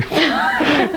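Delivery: sudden high burst of laughter; a high embarrassed giggle. Real, not acted. Teacher recalls students crying.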